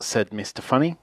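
ordinary delivery

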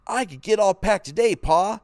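put-on high, loud and eager boy; boy eager to pack answers his father. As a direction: blurt it out with eagerness.

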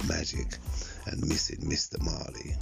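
normal talking voice

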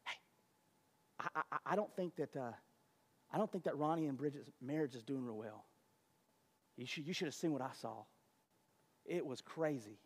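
hushed, low gossiping aside. Retold or imitated. Church neighbour passing on gossip, hushed.

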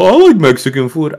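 high, loud burst of mock enthusiasm; imitated random enthusiasm. Police chief butting in about food, imitated enthusiasm. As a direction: pipe up eagerly as the chatty cop. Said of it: "he's, like, so fucking random".

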